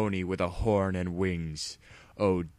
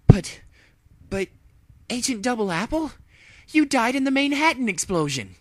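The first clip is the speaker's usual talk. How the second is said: high, loud, shocked pony agent; the stunned hero who thought her partner dead; Rainbow Dash voice; 8 dB louder than usual. Acted. Hero to ex-partner, shocked and high.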